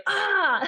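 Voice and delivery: high, loud "aah" of pain; hammed-up scream of pain; realistic pain cry. Shown as a demonstration. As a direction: cry out as if truly hurt, exaggerated. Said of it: "it's not actually being like".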